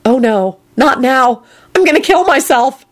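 mounting exasperation, pitch climbing; dismayed. A narrator's reading. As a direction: say it as exasperated despair. Dismayed inner reaction to unwelcome arrival.

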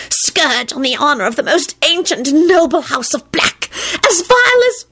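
high, venomous creature rant; hissing elf. A narrator's reading. House-elf railing at the young traitor, high hissing rant. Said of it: "he hissed".

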